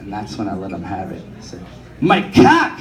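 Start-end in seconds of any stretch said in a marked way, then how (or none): 2.0-2.8 high, loud triumphant yell of the punchline; gleeful yell. Retold or imitated. Son yelling the joke at his father, gleeful shout. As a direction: shout it out like you've finally won.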